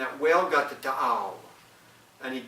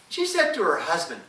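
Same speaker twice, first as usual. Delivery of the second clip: plain reading voice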